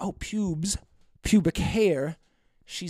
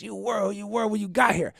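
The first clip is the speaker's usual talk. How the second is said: put-on slurred drunk quote; 5 semitones higher than usual. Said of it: "she says these [name] lyrics".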